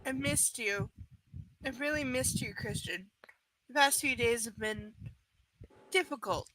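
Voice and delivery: low, soft, measured reading; subdued first-person narration; story narration. A narrator's reading. Reads novel aloud.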